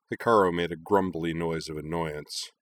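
usual speaking voice

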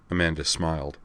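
plain reading voice

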